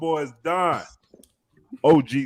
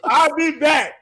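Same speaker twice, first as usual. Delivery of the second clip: higher-pitched shouted mock goodbye; mock calling out; 9 semitones higher, slower than usual. Podcaster mimicking a friend leaving the house, mock calling out. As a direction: call it out like you're heading off.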